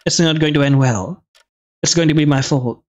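ordinary delivery

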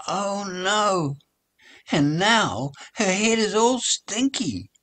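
high, loud dismayed wail; dismayed cry. Acted. Ali finds beans in her hair, high dismayed wail.

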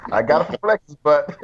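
amused, breaking into laughter; laughing while talking. Real, not acted. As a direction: laugh through your own joke. Laughing at own joke.

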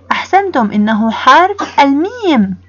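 ordinary talk

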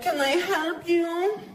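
high, lazy grumpy purr; sleepy sultry greeting. Retold or imitated. Receptionist greeting a guest, sleepy sultry. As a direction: sound just woken from a nap. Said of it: "sounds like they're waking up".

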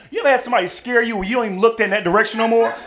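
ordinary delivery

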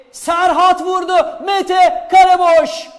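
high, slow, loud chance call; excited football commentator. Real, not acted. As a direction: shout the empty goal. Live chance on goal. (heyecanlı spiker)

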